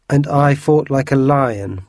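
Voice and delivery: slow, loud and boastful; bragging. A narrator's reading. Porthos boasting to his friends, slow and loud. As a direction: puff up and brag, drawing it out. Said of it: "bragged Porthos".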